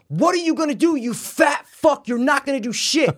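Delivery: very high re-enacted taunt; screaming. Golfer screaming at the older man, taunting. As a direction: yell the challenge with pitch way up. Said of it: "I'm screaming right now going".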